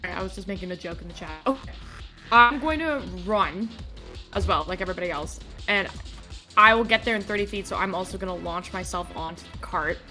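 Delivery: normal talking voice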